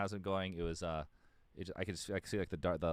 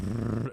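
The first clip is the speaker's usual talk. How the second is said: loud lip-buzzed rumble; imitated airlock rumble; 3 semitones higher, 9 dB louder than usual. Describing the song's airlock opening, loud buzzing imitation. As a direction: buzz out a loud brrr like speakers rattling.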